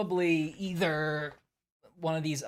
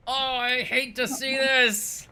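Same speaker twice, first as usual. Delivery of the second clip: mock dread; 9 semitones higher, 8 dB louder, slower than usual.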